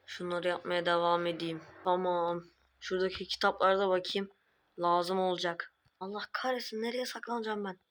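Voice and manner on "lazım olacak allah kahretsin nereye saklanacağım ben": normal talking voice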